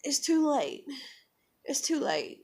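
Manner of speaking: a hint of high toddler voice; retold toddler voice. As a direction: imitate a small girl's short plea. Voices the two-year-old daughter.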